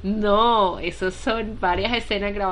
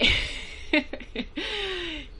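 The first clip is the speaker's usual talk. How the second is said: laugh; 9 semitones higher, 4 dB quieter than usual. Real, not acted. Laughing during chat.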